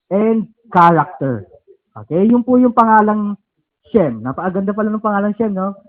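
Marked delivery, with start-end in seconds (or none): none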